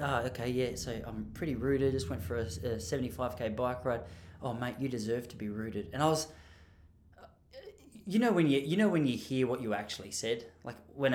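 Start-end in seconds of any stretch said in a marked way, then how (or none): 0.0-5.9 softly murmured mental replay; replayed in his head. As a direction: replay it quietly, as if to yourself.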